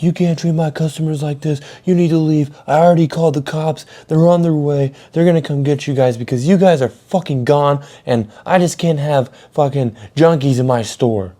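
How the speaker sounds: high, insistent angry manager rant; imitated yelling. Manager kicks junkies out of his store, imitated angry yell. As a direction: rant it high and fast-building, throwing someone out. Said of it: "he comes in yelling".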